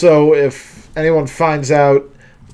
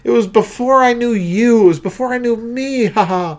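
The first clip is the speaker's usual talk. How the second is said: Michaela impression; 7 semitones higher, faster than usual. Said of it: "Almost Mickey Mouse".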